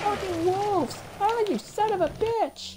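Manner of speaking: high-pitched exasperated swearing; angry cursing. Real, not acted. Player cursing at wolves, angry high outburst. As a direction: curse the attacker at a high pitch.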